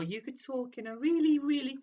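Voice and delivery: high, sing-out happy demo; really happy. Shown as a demonstration. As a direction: pitch it up into exaggerated happiness. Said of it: "happy tone of voice".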